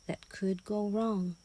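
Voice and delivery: plain reading voice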